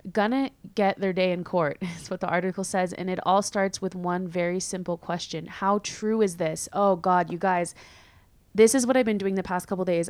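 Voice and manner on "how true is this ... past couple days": ordinary delivery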